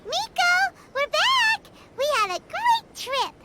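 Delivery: calling out excitedly. Acted. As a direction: shout happily to announce you're back. Arriving back, calling to Miko.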